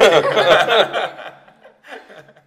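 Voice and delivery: loud, high burst of laughter; real laugh. Real, not acted. Presenter cracks up after own joke, loud laughter.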